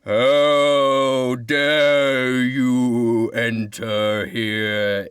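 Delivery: slow, menacing moan from the tree. Acted. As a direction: drag out every word, menacing. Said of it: "it moans, in a menacing way".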